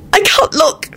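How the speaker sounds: high-pitched sobbed cry; sobbing. A narrator's reading. Elizabeth to Lupin beside the coffin, sobbing. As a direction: wail it through tears. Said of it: "she sobbed".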